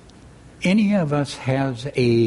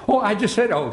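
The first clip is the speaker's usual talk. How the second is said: high, mildly nervous little reply; nervous Piglet; 7 semitones higher, faster than usual. A narrator's reading. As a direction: back away nervously from the remark, pitch up. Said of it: "said Piglet nervously".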